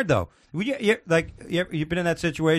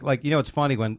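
usual speaking voice

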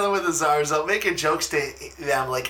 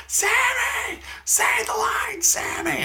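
mock yelling girl; slower than usual. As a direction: imitate a girl yelling at the screen. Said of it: "at the top of her lungs".

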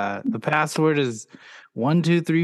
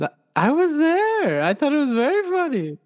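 high put-on voice. Dad in Wonder Woman voice.

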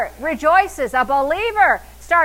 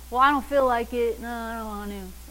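reluctant member; 4 semitones lower, 4 dB quieter than usual. Acted. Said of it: "then you don't really want your answer".

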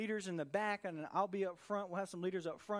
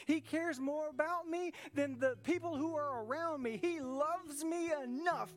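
higher and louder, declaring each line; preaching at full force; 7 semitones higher, 3 dB louder than usual. Real, not acted. Pastor rallies the church against the enemy, declaring in full voice. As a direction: build the praise like a rally cry.

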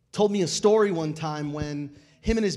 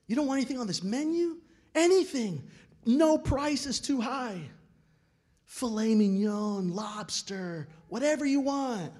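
incredulous urging; 5 semitones higher than usual. Retold or imitated. Friends urging kid at restaurant.

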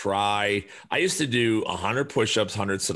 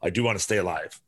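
ordinary talk, nothing marked

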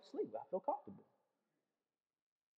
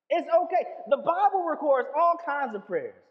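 ordinary talk

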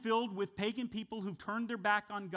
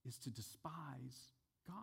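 whisper; 5 semitones lower, 14 dB quieter than usual. Real, not acted. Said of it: "wait a second".

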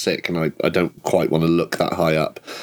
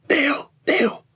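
urgent hushed call, pitched high; whisper yelling. Acted. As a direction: call his name without being heard. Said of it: "it's whispering, whisper shouting".

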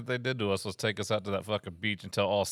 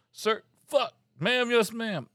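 high-pitched recruit blurting a reply; high, hasty boot-camp response; flustered recruit; 13 semitones higher, 4 dB louder, much slower than usual. Acted. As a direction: blurt it high, correcting yourself in a panic. Acted recruit correcting sir to ma'am.

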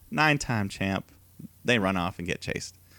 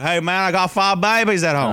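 Southern accent impression; 7 semitones higher, 6 dB louder than usual. Mocking the wrestler's Southern accent for his co-host, loud. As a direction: put on a hammed-up Southern wrestler voice. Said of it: "Whereas Heath Slater be like".

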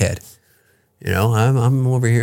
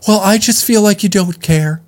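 higher, whiny mock of a hurt partner; 8 semitones higher than usual. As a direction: play the wounded ex, pitched up. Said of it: "I gotta argue with her like I'm an ex".

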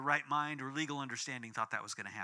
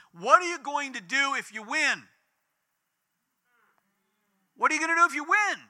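high, loud, rapid shouted question; retold yell; 8 semitones higher, 7 dB louder than usual. Protester yelling at praying family behind them, loud high shout. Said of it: "this woman yells right behind him".

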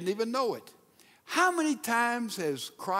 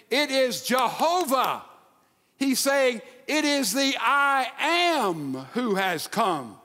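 slow, ringing proclamation, building up; proclaiming; 3 semitones higher, slower than usual. Acted. Preacher declaring Jesus's words to the storm-tossed disciples, slow and ringing. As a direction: announce the divine name with full force.

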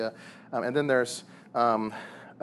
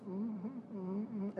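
soft listening mm-hmms; 8 semitones higher, 12 dB quieter, much slower than usual. Retold or imitated. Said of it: "my wife just sitting there going".

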